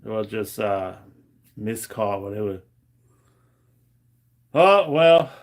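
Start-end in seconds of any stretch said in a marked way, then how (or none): 4.5-5.3 loud exclamation. Real, not acted. Laughing it off.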